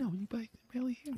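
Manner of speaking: high, very soft little voice; mock soft-spoken. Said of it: "You're soft spoken".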